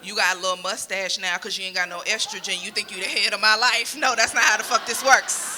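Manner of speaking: loud, furious telling-off; angry rant. Retold or imitated. Comic imagining cursing out her nosy aunt, loud and angry. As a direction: let her have it, full blast. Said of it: "deep in my heart, I said".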